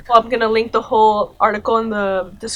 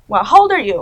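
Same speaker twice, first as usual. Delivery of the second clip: louder mock disbelief; louder incredulous question; 7 dB louder, faster than usual. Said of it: "Also, how old are you?".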